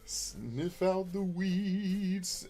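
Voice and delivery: high, slow, stretched-out crooning; crazy sketch character drawing out every word. Acted. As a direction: draw it out high like a comic refrain. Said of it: "that's actually a [name] line. That's him playing, uh, the crazy dude".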